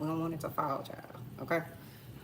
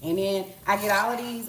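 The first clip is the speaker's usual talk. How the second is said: normal talking voice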